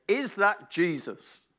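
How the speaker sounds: ordinary talk, nothing marked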